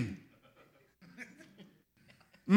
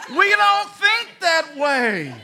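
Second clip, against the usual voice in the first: emphatic preaching; 6 semitones higher, 7 dB louder, slower than usual. Real, not acted. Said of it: "preaching emphasis".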